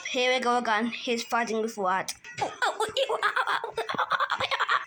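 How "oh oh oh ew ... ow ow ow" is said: laughing mock pain. Toy character gets hurt.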